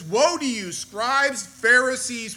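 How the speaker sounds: loud prophetic rebuke. Acted. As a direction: thunder the woe at them. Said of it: "Jesus is speaking now. He says".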